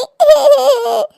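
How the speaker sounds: acted sobbing; crying. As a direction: break down in sobs after a confession. Daughter breaks down after confessing.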